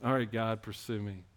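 retelling a quiet prayer of surrender; hushed prayer. Retold or imitated. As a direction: murmur it like a private plea to God. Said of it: "I did this".

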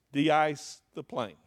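"de-ice the plane" slow and loud, each word punched; preacher slows and leans hard on "de-ice the plane"; emphatic warning. Real, not acted. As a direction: hammer "de-ice the plane" slowly. Preacher hammers the practical point.

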